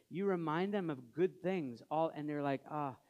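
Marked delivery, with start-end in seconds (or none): 2.7-3.0 low, soft imitation of hopeless indifference; dejected mock brush-off; deep despair. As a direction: imitate someone too despairing to care. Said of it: "was in such deep despair".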